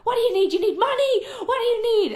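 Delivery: high, loud mock of a panicked manager; flustered boss. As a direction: play the old-school boss frantic to stop their tears. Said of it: "And he was like".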